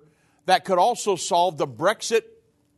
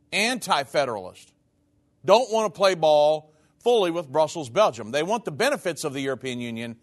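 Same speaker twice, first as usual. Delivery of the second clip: normal talking voice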